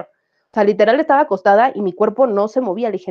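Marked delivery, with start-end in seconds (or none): none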